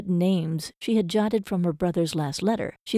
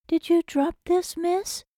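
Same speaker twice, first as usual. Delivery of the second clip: lightly voiced kind woman, higher and soothing; higher-pitched calming voice; 11 semitones higher, slower than usual. A narrator's reading. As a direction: offer it gently, a touch higher. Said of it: "a higher-pitched, but still calming voice soothed".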